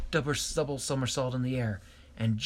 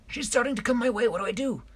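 Shellington squeaking high and anxious; high worried voice; 12 semitones higher, 5 dB louder than usual. A narrator's reading. Shellington worried the girl will find him, high and anxious. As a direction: pitch it way up, fretful.

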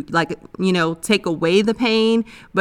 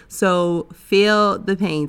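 "feel" stressed and drawn out slowly with a falling end; slow it right down on "feel the pain"; a heartbreak coach urging her listener, slow and insistent; slow, deliberate emphasis; much slower than usual. Shown as a demonstration. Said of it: "don't be afraid to feel the pain".

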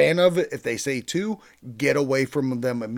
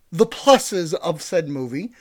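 usual speaking voice

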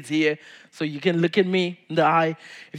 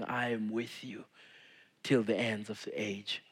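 soft promise; 6 semitones lower, 11 dB quieter than usual. Acted. God's promise spoken softly.

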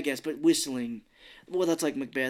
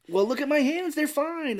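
high, louder mock protest; imitated protesting girl; 13 semitones higher, 4 dB louder than usual. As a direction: plead the case in a high acted voice. Voicing Coraline protesting to adults.

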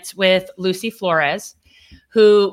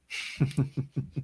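low, soft laugh; chuckle; 6 semitones lower, 11 dB quieter than usual. Real, not acted. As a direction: chuckle quietly at your own joke. Laughs after joke about being international.

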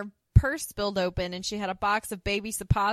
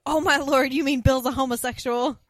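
higher and louder, a gasping shocked exclamation; scandalized Suzanne; 5 semitones higher, 8 dB louder than usual. Retold or imitated. Suzanne jumping to conclusions about the husband, loud and shocked. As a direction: clutch the pearls and blurt it out. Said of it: "And Suzanne on cue".

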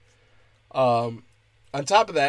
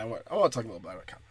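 "I wanna talk a little bit about comedy" rushed out fast and softer under the breath; quick mumbled repeat; 6 semitones lower, 7 dB quieter, much faster than usual. Shown as a demonstration. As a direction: toss the repeat off fast and quiet, like an aside. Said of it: "I wanna talk a little bit about comedy now".

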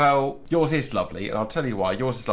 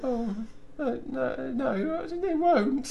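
put-on high, tearful little child protesting; squeaky child nearly crying; child's voice; 4 dB quieter, slower than usual. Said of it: "looked at her mum and went".

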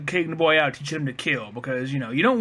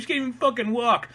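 normal talking voice

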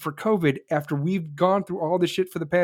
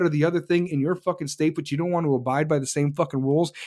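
usual speaking voice

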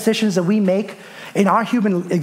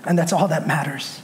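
simple fatherly response; 3 dB quieter than usual. Retold or imitated. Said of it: "simply responded and said".

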